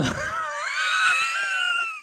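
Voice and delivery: long high-pitched laugh. Real, not acted. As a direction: burst into a high, squealing laugh. Laughing at a viewer's comment.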